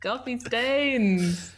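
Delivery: slow, lightly hammed; a goofy mug-holding character, slow; mock character line. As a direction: stretch it out like a silly character. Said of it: "picks up a mug and is like".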